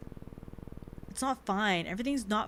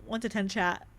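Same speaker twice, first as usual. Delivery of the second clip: ordinary talk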